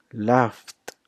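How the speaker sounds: "laughed" as one syllable, laft. Shown as a demonstration. Teacher demonstrating the T sound, "laughed" as laft. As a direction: close "laughed" on a T. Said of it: "There is a T sound".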